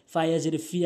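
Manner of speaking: usual speaking voice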